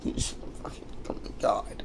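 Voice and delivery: breathy whisper under a laugh; hushed oh my God; whispered aside. Real, not acted. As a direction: whisper it to yourself, amused.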